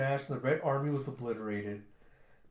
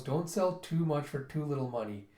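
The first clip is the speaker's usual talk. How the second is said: usual speaking voice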